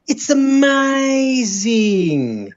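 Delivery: very slow, high put-on voice; Archie impression. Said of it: "All I can say is".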